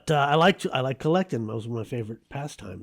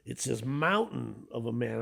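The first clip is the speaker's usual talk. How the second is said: ordinary delivery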